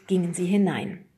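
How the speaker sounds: plain reading voice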